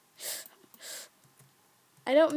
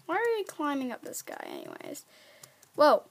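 normal talking voice

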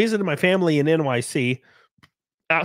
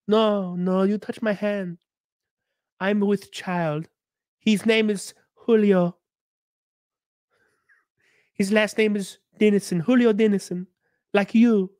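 put-on lightly accented maid, slow and earnest, higher-pitched; Consuela's accent; 4 semitones higher than usual. Maid tells her boss she is pregnant, slow Spanish accent. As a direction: play the maid's slow Spanish-accented confession of a baby.

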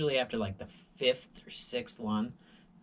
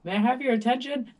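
high, loud, quick announcement; mock intercom announcer; 12 semitones higher, 7 dB louder, faster than usual. Building security on the intercom, high loud announcer voice. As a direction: play the security guy over the intercom. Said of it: "and then it goes".

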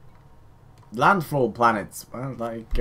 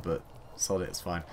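"sod it, it's fine" mumbled much softer and lower; muttered aside; 7 semitones lower, 11 dB quieter than usual. Real, not acted. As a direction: toss off "sod it, it's fine" under your breath, softly. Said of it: "stop saying sod it".

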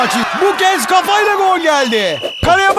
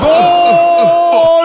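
screaming football commentator; 4 dB louder than usual. Acted. Goal called in commentary.

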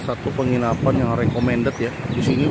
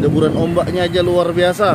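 excited; 4 semitones higher, 7 dB louder than usual. Real, not acted. Awed by crashing waves.

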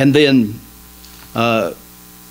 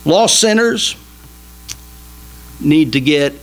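ordinary delivery

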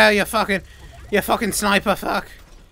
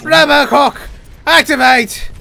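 loud, high catchphrase call; mock heroic shout; 7 semitones higher, 8 dB louder than usual. Player activates robot character, mock heroic shout. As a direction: shout it like a robot hero's battle cry.

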